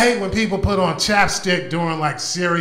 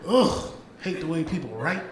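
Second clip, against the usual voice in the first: ordinary talk, nothing marked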